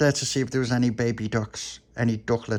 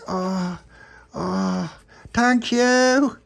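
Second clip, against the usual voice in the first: very high, tiny grateful creature voice; little puppy; 10 semitones higher, 5 dB louder, much slower than usual. Acted. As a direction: squeak out your thanks like a little pup. Puppy character voice.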